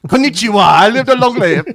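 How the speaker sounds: very loud, high mock greeting; abrupt comic voice switch. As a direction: blurt it in a hammed-up new voice. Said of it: "immediately he's like".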